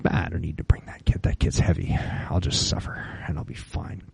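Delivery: breathless fast whisper. Retold or imitated. Young self dismissing the gear, fast whisper. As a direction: toss it off under your breath. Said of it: "And I was like".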